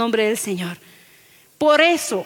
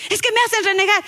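niño quejoso imitado; 7 semitones higher, faster than usual. Retold or imitated. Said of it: "niño responde enojado".

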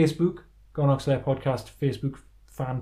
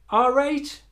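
high-pitched Geordie put-on; squeaky north-east voice; 14 semitones higher than usual. Voicing a character, Geordie "pet.

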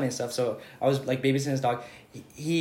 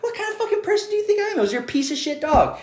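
higher, laughing mock outrage; retold heated comeback; 8 semitones higher, 4 dB louder than usual. To his roommate over the trashed apartment, mock outrage. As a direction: replay the indignant rant, pitch climbing.

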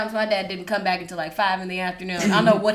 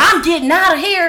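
put-on higher, louder defiant child; defiant kid; 6 semitones higher, 6 dB louder than usual. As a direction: storm off like a kid. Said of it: "packing up his shit to leave".

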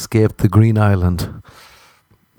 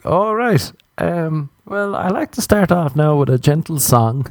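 mock awkward reply; 7 semitones higher than usual. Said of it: "and I was like".

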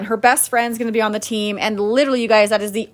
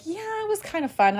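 daughter's voice; 10 semitones higher, 7 dB quieter than usual. Retold or imitated. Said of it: "she's like".

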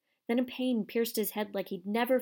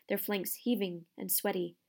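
plain reading voice

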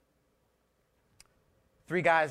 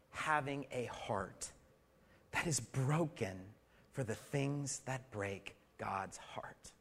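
softer and slower, fading into a whisper; slow hushed whisper; 2 semitones lower, 8 dB quieter, slower than usual. Acted. Preacher to congregation, whispered slowly. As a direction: let it sink to a whisper.

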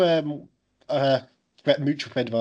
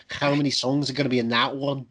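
ordinary talk, nothing marked

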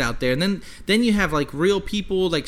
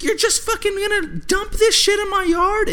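much higher indignant woman's voice; outraged woman. Retold or imitated. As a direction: play the furious homeowner, way up high. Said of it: "she's like".

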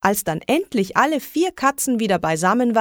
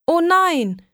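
high, loud oh no; two girls hearing the cat ran off, dismayed; dismayed cry; 9 semitones higher, 3 dB louder than usual. A narrator's reading. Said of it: "riefen Isabella und ich".

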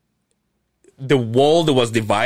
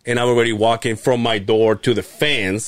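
usual speaking voice

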